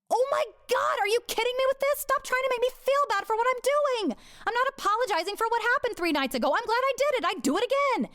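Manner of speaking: high, fast, fed-up protest; exasperated outburst. Retold or imitated. To Robert mocking her faith, exasperated high protest. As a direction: throw his guilt trip right back at him.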